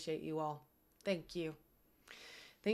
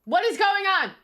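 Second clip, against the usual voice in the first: much higher and louder; surprised outburst; 13 semitones higher, 12 dB louder than usual. Real, not acted. Streamer startled by the raid screen. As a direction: blurt it in surprise.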